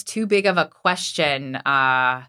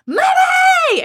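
put-on a child's squeal of Mommy, far higher and louder; excited kid; 8 dB louder than usual. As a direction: shout it like a thrilled five-year-old. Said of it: "she's like".

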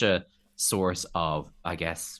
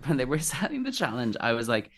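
ordinary talk, nothing marked

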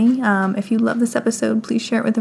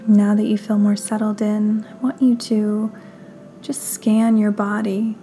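guided meditation; 5 dB quieter than usual. Acted. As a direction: guide them gently through breath and body, unhurried.

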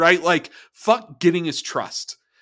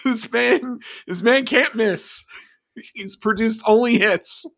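higher, slowed, grand overselling praise; mock hype; 7 semitones higher than usual. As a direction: sell him like a trailer voice. Ironic praise of a producer.

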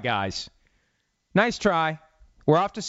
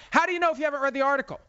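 higher and fast, disbelieving; incredulous; 10 semitones higher, much faster than usual. Real, not acted. Host scoffing at the coach's dodge, incredulous. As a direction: fire back in disbelief.

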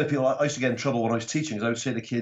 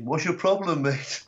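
mock challenge called out higher; retold shout; 6 semitones higher, slower than usual. Heckler's boyfriend challenging the comic across the bar, mock shout. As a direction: imitate a heckler calling across, pitched up without full volume. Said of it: "he shouted over something to me".

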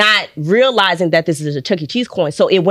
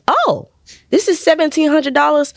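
surprised; 10 semitones higher than usual. Retold or imitated. Bank lady surprised at amount.

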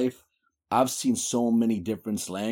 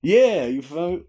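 normal talking voice

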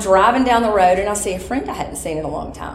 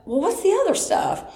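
puzzled question; 13 semitones higher than usual. Retold or imitated. Confused follow-up question.

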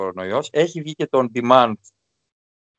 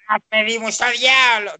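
acted shout; 7 semitones higher than usual. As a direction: yell it like an overacted series scene. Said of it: "Ναι, που φωνάζουν". (παιχτή φωνή, σαν σε σειρά)

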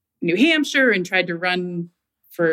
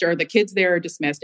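ordinary delivery

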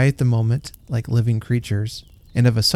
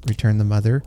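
ordinary delivery, nothing marked

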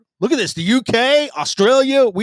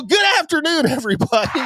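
show greeting; 10 semitones higher than usual. Acted.

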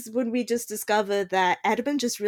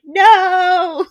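higher, much louder wail of no; anguished no; 11 semitones higher, 13 dB louder than usual. Retold or imitated. Edmund crying out as Dolly kills the chicken, loud and high. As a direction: cry out as the chicken dies. Chicken snapped in front of him.

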